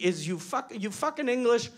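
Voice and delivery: ordinary delivery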